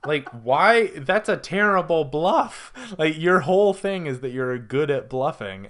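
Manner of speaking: loud, high-pitched incredulous protest; exasperated disbelief. Real, not acted. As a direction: protest in baffled, amused outrage. Mocking a terrible bluff.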